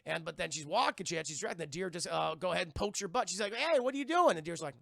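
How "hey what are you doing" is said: startled high yelp of a question; sudden startled question. Retold or imitated. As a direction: jump as if poked from behind. Tulip startled by deer.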